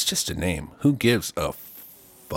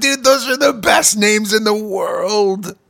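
higher and louder, slow rapture; gushing; 11 semitones higher, 5 dB louder than usual. Real, not acted. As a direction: squeal over the names. Gushing over names.

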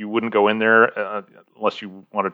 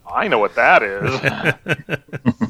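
laughing; 4 semitones higher, much slower than usual. Retold or imitated. Laughing recognition of old token.

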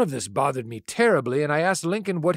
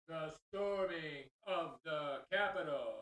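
echoing, far-off booming voice; slow line drenched in echo; digital echo; 4 semitones higher, 15 dB quieter, slower than usual. Shown as a demonstration. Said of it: "what about an echo effect for that line".

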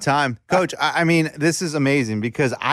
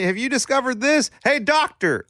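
high, pitched-up hail; exaggerated customer-style call; mock calling out; 9 semitones higher than usual. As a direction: call out eagerly for attention. Absurd example of calling out.

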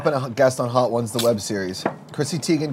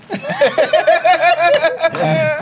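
high giddy laugh; 6 dB louder than usual. Real, not acted. Can't feel anything.

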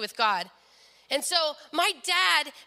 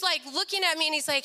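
ordinary delivery, nothing marked

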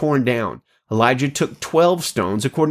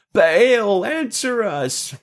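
calling out; 9 semitones higher, much slower than usual. Acted. Prophets cry out to Baal.

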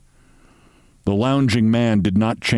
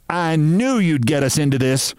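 higher-pitched, scolding complaint; mildly furious; 6 semitones higher than usual. A narrator's reading. Sylvia blaming her companion, understated fury. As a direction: scold with rising indignation, not shouted. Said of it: "Sylvia was furious".

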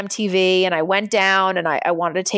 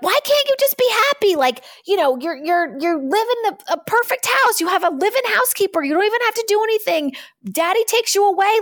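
high, strained disbelief at her mother; reliving her younger self's frustrated outburst; pitched up high, pushing her to cheer up; exasperated pleading; 12 semitones higher than usual. Retold or imitated. Retold scolding of depressed mother.

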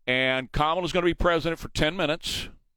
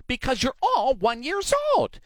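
mock kindergarten teacher; 9 semitones higher than usual. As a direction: talk down to them like one-year-olds. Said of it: "She sounds like a Sesame Street host".

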